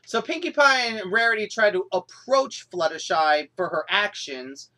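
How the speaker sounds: ordinary talk, nothing marked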